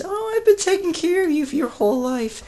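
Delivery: high-pitched mock whine of a mother; complaining mother. Son mimics mother's complaint, high mocking whine. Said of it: "And she complains because".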